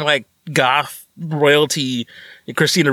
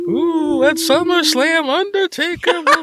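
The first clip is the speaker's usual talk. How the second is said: high, slow hyped ring announcer; wrestling announcer; 10 semitones higher, slower than usual. Pretend. Host slipping into a mock wrestling promo. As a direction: hype the match like a ringside caller.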